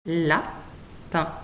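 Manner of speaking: "Lapin" split as La... pin; long pause inside "Lapin", after La; syllable by syllable. Shown as a demonstration. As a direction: sound out "Lapin" in two separate syllables. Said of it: "il y a deux syllabes".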